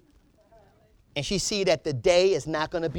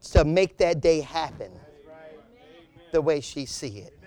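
normal talking voice

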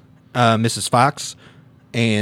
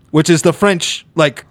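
ordinary delivery

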